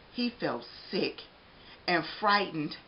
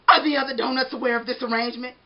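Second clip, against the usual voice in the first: donut character voice; 6 semitones higher, 8 dB louder, faster than usual. Acted. Arnie voice.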